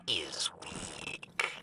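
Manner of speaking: hushed, breathy villain's whisper; menacing whisper. Acted. Villain whispering to her ally about Aramis's weakness. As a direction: savor the word weak in a whisper.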